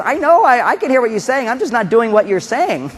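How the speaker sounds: put-on defiant little boy. Little boy to his mother, high defiant kid voice. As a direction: talk back like a stubborn kid. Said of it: "The little boy is hearing you. He says".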